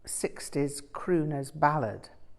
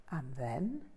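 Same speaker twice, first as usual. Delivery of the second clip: hushed, barely voiced question; almost a whisper; 2 semitones lower, 10 dB quieter than usual. A narrator's reading. Teacher hushed, asking his student what comes next. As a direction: lean in and ask it almost whispering. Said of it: "almost in a whisper".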